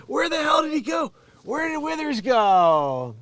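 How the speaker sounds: loud, high-pitched holler; calling out. Acted. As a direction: shout after someone who has vanished. Acted calling after vanished man.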